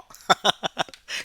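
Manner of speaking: short burst of laughter; bright laugh. Real, not acted. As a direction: laugh out loud at yourself. Amused at own repeating.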